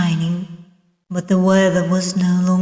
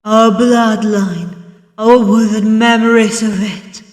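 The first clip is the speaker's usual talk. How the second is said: dramatic narration swelling to a forceful peak; building intensity; 4 semitones higher, 8 dB louder than usual. A narrator's reading. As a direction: rise to a loud, higher climax. Dark prologue building to its climax.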